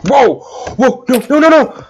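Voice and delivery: high, loud alarmed yelps; panicked cries. Real, not acted. Player sees the attempt going wrong, panicked cries. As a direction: cry out in rising panic.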